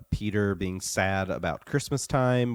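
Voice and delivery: ordinary talk, nothing marked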